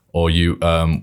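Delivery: ordinary talk, nothing marked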